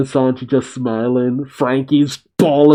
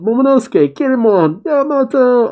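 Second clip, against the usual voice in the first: high calls, name after name; crying out; 10 semitones higher than usual. A narrator's reading. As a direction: call each friend in a high, carrying voice. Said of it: "the Straw Hats cry out".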